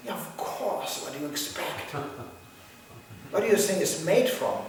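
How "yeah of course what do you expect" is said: ordinary talk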